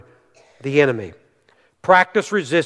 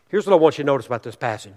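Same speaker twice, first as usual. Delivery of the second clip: ordinary talk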